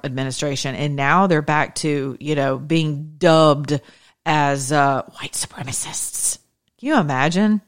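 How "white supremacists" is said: whispered label. Acted. Talking to viewers about ICE agents, a scornful whisper. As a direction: breathe out the phrase like a dirty word.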